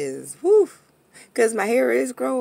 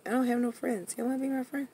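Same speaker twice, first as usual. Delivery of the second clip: normal talking voice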